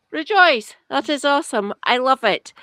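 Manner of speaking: loud, high burst of celebration; mock rejoicing. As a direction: cheer it with exaggerated enthusiasm. Mock cheering for devolution.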